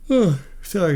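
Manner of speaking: talking mid-yawn; words stretched by a yawn. Real, not acted. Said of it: "Sorry, I keep yawning".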